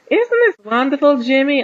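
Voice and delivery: high, loud, badly faked southern belle; attempted southern accent. A narrator's reading. Jezebel to her captive groom at the altar, attempted southern accent. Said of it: "whispered to James in her southern".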